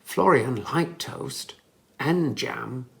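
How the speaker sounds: plain reading voice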